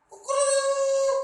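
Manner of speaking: piercingly high, loud cock-crow; rooster crow. Shown as a demonstration. Preacher imitating the morning rooster, loud crowing. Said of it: "Kalau siang".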